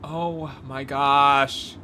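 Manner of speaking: usual speaking voice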